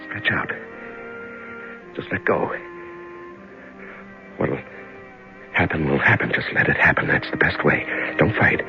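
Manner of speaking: soft, hushed calming words to himself; self-soothing murmur. Acted. Frightened prisoner lulls himself into calm, soft and hushed. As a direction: breathe through it and let go.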